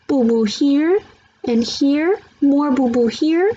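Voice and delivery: put-on little boy proudly listing his boo-boos; toddler voice. A narrator's reading. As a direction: show off each scrape like a toddler. Toddler showing his wounds.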